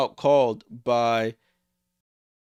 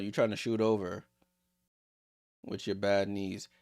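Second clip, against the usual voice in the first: ordinary talk, nothing marked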